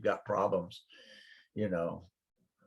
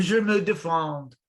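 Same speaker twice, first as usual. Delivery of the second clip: French reply; 8 semitones higher, 7 dB louder than usual. Retold or imitated. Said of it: "they say".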